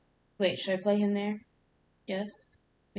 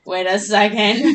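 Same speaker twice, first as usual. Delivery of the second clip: laughing; 15 dB louder, slower than usual. Real, not acted. Laughing as he copies her.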